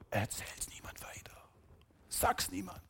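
hushed whisper, almost no voice; secret whisper. Retold or imitated. Jesus forbids telling the miracle.

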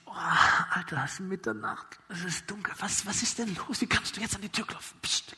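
put-on hushed, grumpy reply so the kids sleep; annoyed whisper. Sleepy father hushing the friend at the door, annoyed whisper. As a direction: whisper back, irritated and tired.

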